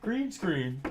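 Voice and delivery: high-pitched mocking chatter voice; jeering high little voice; mocking everybody. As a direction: mimic the commenters in a high voice. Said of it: "everybody's like".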